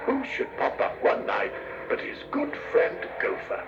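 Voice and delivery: plain reading voice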